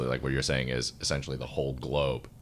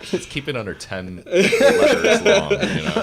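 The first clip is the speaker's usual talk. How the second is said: much higher and louder, laughing through the words; 10 dB louder than usual. Real, not acted. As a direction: crack up while joking, slowly. Laughing joke about long name.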